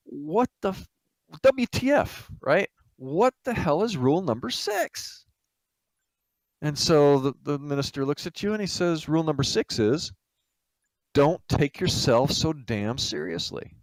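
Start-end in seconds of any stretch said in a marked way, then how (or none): 0.1-5.3 high-pitched incredulous demand; exasperated disbelief. Acted. Exasperated guest demands an explanation. 9.1-13.8 deliberate pronouncement. Acted. Minister delivers the rule.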